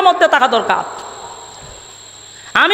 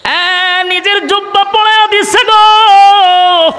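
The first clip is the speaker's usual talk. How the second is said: high, slow, long-held chanted cry; amazed wailing cry; 4 semitones higher, 3 dB louder, much slower than usual. Acted. Hypocrites astonished he gave his own robe, high drawn-out wail. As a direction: wail it out high and hold the last word.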